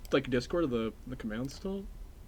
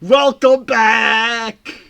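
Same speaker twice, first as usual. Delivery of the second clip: long, loud stretched welcome; slow, loud, hammed-up greeting; drawn-out welcome; 7 semitones higher, 11 dB louder, much slower than usual. Real, not acted. Streamer greeting chat, slow loud welcome.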